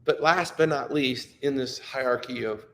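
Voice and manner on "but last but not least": normal talking voice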